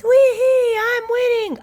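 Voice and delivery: put-on very high, loud child squeal; gleeful whoop. A narrator's reading. Little sister slides down ahead of brother, gleeful.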